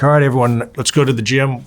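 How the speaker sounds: rally the group like a coach moving drills along; imitated coach's call. Coaches calling the group onward.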